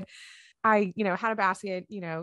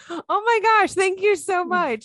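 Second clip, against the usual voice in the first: much higher, louder, gushing thanks; excited gushing; 12 semitones higher, 7 dB louder than usual. Retold or imitated. Retelling her thrilled reply to a stranger's compliment, gushing and high. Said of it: "I got so excited".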